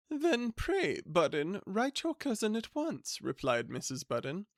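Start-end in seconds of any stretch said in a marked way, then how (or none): 0.1-3.2 high eager wife's voice; Mrs Budden's voice. Acted. Wife urging her husband to write, in a high eager voice. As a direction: pitch it up like an eager, scheming wife.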